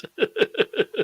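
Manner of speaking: high chuckling giggle; quick high burst of laughter; real laugh. Real, not acted. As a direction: giggle at your own silly excuse. Laughs at own missing screwdriver story.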